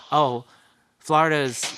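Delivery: ordinary talk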